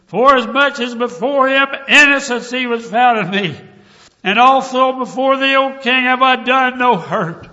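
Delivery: high, loud proclamation as Daniel; loud declaration. Acted. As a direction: call it up to the king with force. Preacher voicing Daniel calling out.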